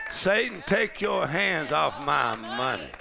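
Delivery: Satan called high and loud, then slow forceful command; loud rebuke. Shown as a demonstration. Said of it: "Oh, y'all got this already, huh?".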